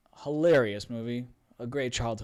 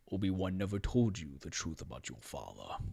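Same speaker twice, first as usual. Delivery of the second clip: low, hushed, soft menacing villain; Darth Vader; 5 semitones lower, 6 dB quieter than usual. Acted. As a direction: drop into a deep, breathy whisper. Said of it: "Vader says to him, see if I can get this right".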